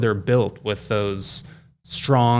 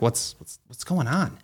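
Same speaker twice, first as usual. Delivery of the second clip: stunned; 2 semitones higher, 6 dB quieter than usual. Retold or imitated. Dad still reeling from the nurse's news, stunned. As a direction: ask it small and stunned, faltering at the start. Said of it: "trying to pick my jaw up off the floor".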